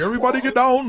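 high-pitched mock Austrian accent; Arnold Schwarzenegger. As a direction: call it out as an action hero with an Austrian accent. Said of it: "It's like the Arnold Schwarzenegger".

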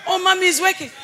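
high-pitched imitation of a young man; mimicked reassurance. Young man assuring his mentor all is working, high mimicked reassurance.

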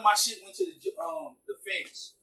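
distant, faint voice from afar; sounding far off the microphone; far from mic. Real, not acted. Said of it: "it sounds like you're far away now".

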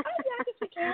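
high, offhand imitation of her boyfriend; imitating boyfriend. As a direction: mimic his casual agreement. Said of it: "And he said".

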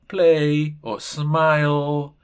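"play" and "smile" said very slowly; slow example words. Shown as a demonstration. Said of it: "por ejemplo". ("play" y "smile" muy despacio)

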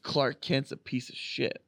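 low, quiet, slow murmur; hushed, low sneaky jab; acted whispering. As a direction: mutter it slowly under your breath. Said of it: "quietly walk around whispering".

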